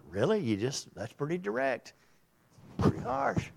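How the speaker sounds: high, mock-surprised aside; a hint of a shocked John; lightly voiced John the Baptist. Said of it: "John the Baptist is going".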